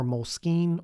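"Moleskine" said as mole-skeen; pronunciation variant. Shown as a demonstration. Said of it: "I don't even know if you say".

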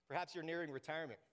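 ordinary talk, nothing marked

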